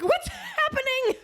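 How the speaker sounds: high, drawn-out panicked question; alarmed cry. Retold or imitated. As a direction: squeal it in mock bewilderment. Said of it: "I'm just like".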